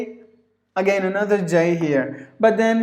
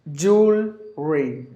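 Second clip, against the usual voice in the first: split into syllables; slower than usual. Shown as a demonstration. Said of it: "the current English is without the L".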